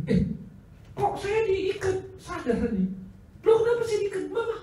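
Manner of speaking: put-on high, bewildered young voice waking up tied; confused boy. Preacher voicing the freed boy asking why he's tied.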